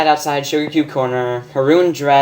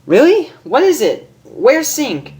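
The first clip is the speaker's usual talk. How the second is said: high eager squeal; excited high girl asking; Rainbow excited; 13 semitones higher, 4 dB louder than usual. Acted. Rainbow asking Pinkie about her boyfriend, high excited.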